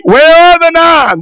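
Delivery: Where pitched high, rising to a cry; preacher's high cry. Acted. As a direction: cry it out high to stir the congregation. Preacher cries out the question.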